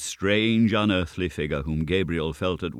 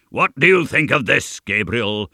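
high, loud boastful question; goblin taunt; 5 semitones higher, 8 dB louder than usual. A narrator's reading. Goblin kicks up his feet at Gabriel, loud taunt.

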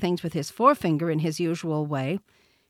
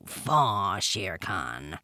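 slow panther voice sinking low; low, contemptuous big-cat voice; Bagheera the panther, disgusted; 8 semitones lower, much slower than usual. A narrator's reading. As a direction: repeat it slowly, then spit out disgust.

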